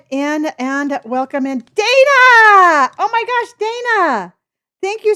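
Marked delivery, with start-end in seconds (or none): 1.7-2.9 loud, high delighted call of a name; thrilled squeal of greeting; excited shout. Real, not acted. Streamer greets arriving viewer Dana. 3.0-5.1 excited. Real, not acted. Streamer thrilled by Dana's gift.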